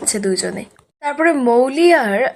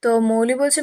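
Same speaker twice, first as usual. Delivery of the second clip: ordinary delivery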